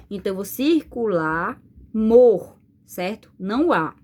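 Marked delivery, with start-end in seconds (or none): 1.9-2.6 "mor" set apart, loud and drawn out; stressed syllable. Shown as a demonstration. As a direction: lean hard on "mor". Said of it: "Qual é a sílaba que eu falo com mais forte". ("mor" forte e alongado)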